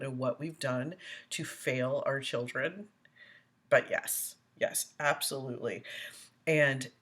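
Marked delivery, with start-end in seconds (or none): none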